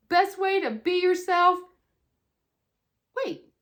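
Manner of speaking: ordinary delivery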